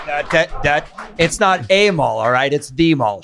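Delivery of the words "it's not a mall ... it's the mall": "a" raised and said as "ay", answered by "the" as "thee"; line reading contrasting "a" with "the"; stress on A and THE. Retold or imitated. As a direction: lean hard on "a", then correct it with a stressed "the". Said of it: "hit those certain words, the".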